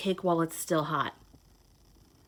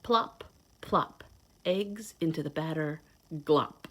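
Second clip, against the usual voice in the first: lively storybook read-aloud; reading to children; 4 semitones lower, slower than usual. Acted. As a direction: read it like a picture book to little kids. Reads picture book to children.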